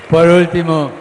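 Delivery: shouted high over the crowd; loud call. Real, not acted. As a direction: call it out loudly to win attention.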